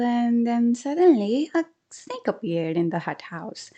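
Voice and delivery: ordinary delivery, nothing marked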